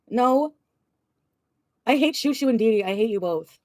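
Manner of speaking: usual speaking voice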